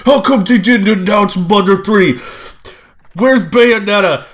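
high mock complaint voice; whiny fans. Speaker mocks everyone on social media, whiny fan voice. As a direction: imitate outraged fans demanding their game. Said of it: "everyone's like".

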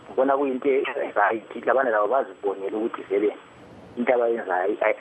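ordinary delivery

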